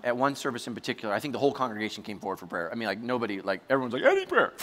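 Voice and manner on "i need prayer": high, squeaky mock plea; needy crowd member. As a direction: pipe up pleadingly for prayer. Mimicking eager crowd.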